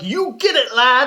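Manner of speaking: high, loud imitation Scottish cheer; Scottish fans cheering at the table; mock Scottish cheer. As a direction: shout it like a rowdy Scottish fan. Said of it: "three people there that were like".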